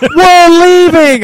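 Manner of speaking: high, slow, booming mock holler; imitating shouting dad. Son mimics dad announcing they're leaving, loud drawn-out yell.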